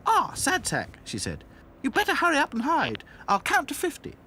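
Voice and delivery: high, loud, lively doll voice; Dotty's eager voice. A narrator's reading. Dotty telling Sad Sack to hurry and hide, eager. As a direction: call it out high and busy. Narrated story, Dotty speaks.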